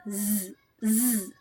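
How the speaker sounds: ज as a soft, held "zzz"; buzzed z sound. Shown as a demonstration. Teacher showing the Z sound, ज as held "zzz". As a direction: buzz ज low with no vowel. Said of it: "तो इसको बोलते हैं".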